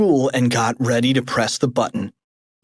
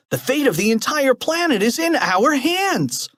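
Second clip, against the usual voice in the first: put-on high kid voice; 11 semitones higher than usual. Character declares.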